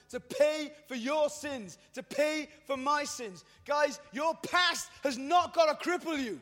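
put-on high, loud and slow plea to the young; fervent preacher's appeal; impassioned preaching. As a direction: press the point home slowly and loudly.

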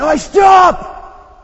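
sudden shout. Retold or imitated. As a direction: yell it like stopping a child from a fire. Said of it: "you had to shout like that".

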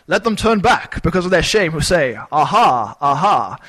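loud, grand Shakespearean stage reading; Shakespearean accent. Shown as a demonstration. Preacher mocking the ESV with a hammed-up Shakespearean accent. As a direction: declaim it like an old Shakespearean actor. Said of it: "read it in some sort of Shakespearean accent".

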